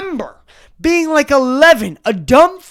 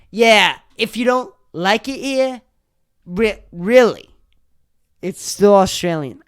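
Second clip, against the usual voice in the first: Boston accent attempt; 7 semitones lower, 5 dB quieter than usual. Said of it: "these people on the news are like".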